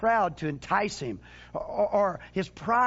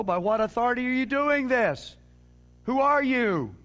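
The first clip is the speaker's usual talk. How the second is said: raised, higher voice demanding an answer; indignant challenge; 5 semitones higher, 4 dB louder than usual. Acted. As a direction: challenge an upstart like an affronted official. Acts authorities challenging John.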